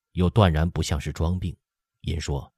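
plain reading voice

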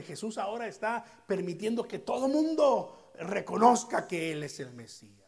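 usual speaking voice

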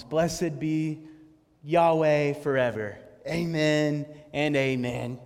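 loud, ringing doxology; proclaimed blessing. Acted. Preacher proclaiming the closing blessing to the congregation, loud. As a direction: call it out like a benediction.

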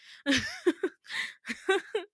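high-pitched laugh breaking the anger. Real, not acted. Laughs after angry outburst.

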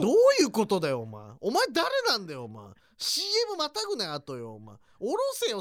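high, loud comic tirade; exasperated shouting. Acted. Straight man scolds stalling partner, exasperated shouting. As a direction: explode at the endless stalling.

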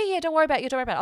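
imitating an eager-to-help store lady; retold reassurance. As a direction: wave the worry away, as retold.

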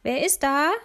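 high, loud call down the stairwell. A narrator's reading. Little brother calling down to the door, loud high call. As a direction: shout the question downstairs.

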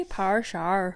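slow Northern Irish "par char" for copying; Northern Irish accent. Shown as a demonstration. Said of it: "That's my Northern Ireland".

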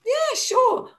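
high, eager squeaky yes; a carefree instant yes; chirpy yes. Retold or imitated. To the bar boss, a high eager yes. Accepting summer job breezily.

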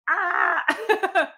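short, high, sharp yelp of annoyance; mock irritated yelp. Coach imitating her pre-period self snapping at someone, a high, irritated yelp. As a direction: snap out a cranky little cry.

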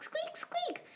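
very high, soft squeaky voice; scavenger squeaks. Pretend. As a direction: squeak like a tiny pretend critter.

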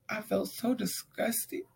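hushed, voice almost gone; soft, breath-only confession; ashamed near-whisper. Real, not acted. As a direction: let the shame drop it to a whisper. Recalls her shame, voice gives out.

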